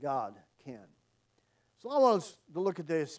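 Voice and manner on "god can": "God can" lower and softer, falling; quiet firm declaration. Shown as a demonstration. Preacher repeats the answer, "God can" low and firm. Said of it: "we need to be saying".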